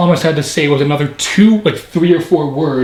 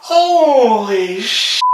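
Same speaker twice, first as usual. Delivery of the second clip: drawn-out, higher gasp of disbelief; real astonishment, slow and high; stunned surprise; 6 semitones higher, much slower than usual. Real, not acted. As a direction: catch yourself mid-curse in shock. Shock that the joke came true.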